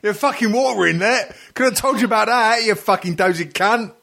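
high, exasperated mock telling-off; mock scolding. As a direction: berate them like a know-all tradesman. Acting the scornful know-all plumber.

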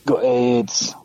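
broad northern English delivery; flat vowels, northern English; northern accent. Shown as a demonstration. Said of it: "you'd have said it better because of your northern accent".

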